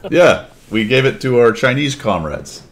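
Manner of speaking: put-on hammy Russian accent, proud comrade. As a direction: play a boastful Soviet in Russian accent. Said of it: "That's as good as my Russian accent gets".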